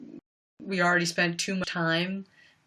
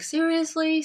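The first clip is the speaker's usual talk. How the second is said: a higher, incredulous first "Seriously?"; exasperated disbelief; 10 semitones higher than usual. Retold or imitated. Reacting to bullies' messages, exasperated first "Seriously?". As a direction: ask "Seriously?" in fed-up disbelief. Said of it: "and it was just like".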